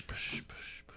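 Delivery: fading whispered repeats like an echo; spooky whisper echo. Pretend. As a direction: breathe the word out as it dies away. Jokey echoing of "burning bush.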